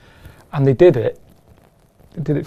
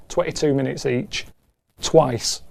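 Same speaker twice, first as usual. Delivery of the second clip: ordinary talk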